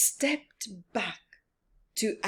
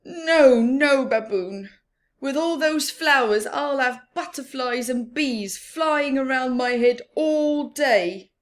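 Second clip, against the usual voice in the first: loud, high protesting no; complaining to his friend; loud, high grumpy Rhino; fed-up Rhino; 6 semitones higher, 7 dB louder than usual. Acted. Rhino complains about flowers.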